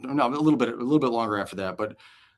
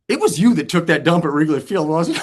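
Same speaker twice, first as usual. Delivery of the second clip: louder, higher accusation; accusing; 7 semitones higher, 7 dB louder than usual. Retold or imitated. Casey cornering Johnny at his locker, loud accusation. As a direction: corner him with it.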